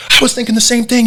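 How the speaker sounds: dad lighting up at the hotel, imitated high and excited; ecstatic dad. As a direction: gush it, lit up with joy. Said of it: "he was like".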